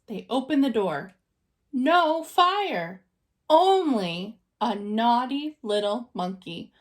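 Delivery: plain reading voice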